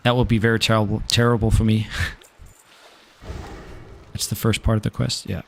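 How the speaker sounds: ordinary talk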